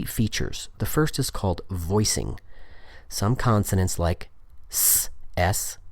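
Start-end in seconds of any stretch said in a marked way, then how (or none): 4.7-5.8 "S" as a hiss without throat vibration; voiceless S sound. Shown as a demonstration. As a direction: make "S" a pure unvoiced sss. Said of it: "are voiceless".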